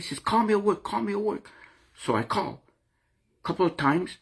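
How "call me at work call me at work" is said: higher-pitched mock of her demanding; imitating his angry girlfriend. Quoting his girlfriend who got mad, high mock insistence. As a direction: pitch it up and nag like she did. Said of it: "she got mad at me".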